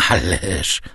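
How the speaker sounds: usual speaking voice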